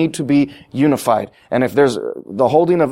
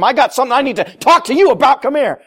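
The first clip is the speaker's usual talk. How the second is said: mock confrontational; 10 semitones higher than usual. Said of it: "don't go up to them".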